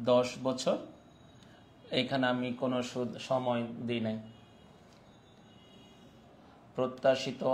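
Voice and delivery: low, soft voice with long pauses between items; dictating while writing. Real, not acted. Teacher dictating bond question data to students, low and quiet. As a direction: murmur each figure as you write it down.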